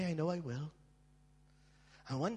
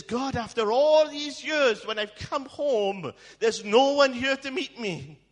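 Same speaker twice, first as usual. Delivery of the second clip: loud, slow, high wail to God; anguished cry; 9 semitones higher, 9 dB louder, slower than usual. Retold or imitated. Hurt man crying out to God, loud anguished wail. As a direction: cry out the hurt, much louder and higher.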